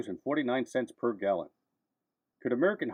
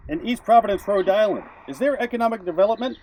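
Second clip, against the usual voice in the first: punchy newsreel narration, louder and higher; old newsreel narrator; 6 semitones higher, 5 dB louder than usual. Acted. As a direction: announce it like a vintage newsreel. Punchy rhetorical news checklist.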